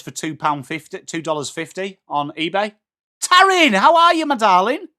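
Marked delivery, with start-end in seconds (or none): 3.2-4.9 bright, much higher and louder hello; big high cheery welcome; high excited greeting. Real, not acted. Greeting a chat viewer by name.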